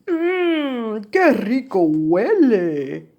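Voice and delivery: hammed-up drawn-out yum; savouring badger. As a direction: voice a storybook animal smelling something delicious. Badger sniffs food in the story.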